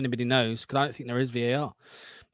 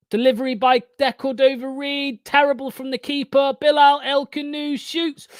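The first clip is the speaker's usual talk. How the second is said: commentary building higher as the attack develops; live play-by-play; 7 semitones higher, 5 dB louder than usual. Real, not acted. Watchalong host calling a Leicester attack, rising commentary. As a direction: call the attack live, stretching the key words.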